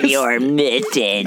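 slow, badly faked villain voice; botched villain impression. Friend keeps trying the villain voice while others laugh, slow and botched. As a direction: drag it out as the voice falls apart. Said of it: "Just completely falling, falling away from it".

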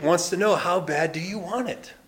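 ordinary talk, nothing marked

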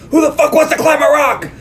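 high, loud outraged yell at the customers; furious shout. Retold or imitated. Salesman flipping out at a couple who want rock climbing, yelling. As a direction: blow up at them like a hothead salesman.

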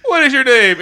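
hammed-up high voice; mock high-pitched query. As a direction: ask with mock surprise. Voiced challenger quote, high.

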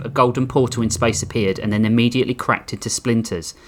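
usual speaking voice

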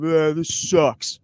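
slow, low, sulky groan of a line; bored teen. Retold or imitated. Comic mocking teen energy, slow sulky groan. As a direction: drag it out like a moody teenager. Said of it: "that teenage energy of".

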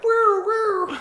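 high whooping siren sound; siren imitation. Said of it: "it'll just go, like".

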